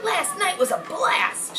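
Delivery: put-on high, slow girlish character voice; lifted, dreamy damsel voice; Charlotte's voice. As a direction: stretch it out high and dreamy like a swooning girl.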